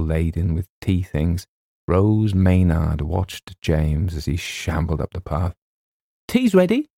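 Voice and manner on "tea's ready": high, sing-out cheerful call; lilting, carefree announcement. A narrator's reading. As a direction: call out gaily, pitched right up. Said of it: "she called gaily".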